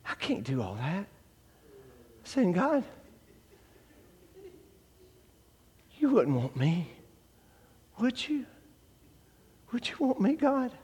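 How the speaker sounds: soft, broken-off pleading to God; hushed plea. Retold or imitated. Lost addict whispering to God whether he's wanted, hushed plea. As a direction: plead quietly, almost afraid to ask.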